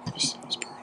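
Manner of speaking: breathy whispered aside; whispering. Real, not acted. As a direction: drop to a whisper. Whispered aside after story.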